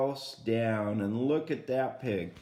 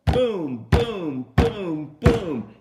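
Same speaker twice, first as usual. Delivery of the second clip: booming knock sounds, louder each time; loud door booms; 3 semitones higher, 9 dB louder, much slower than usual. Acted. Storyteller to children, wolf banging on the straw house, loud booms. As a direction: pound out each boom like a fist on wood.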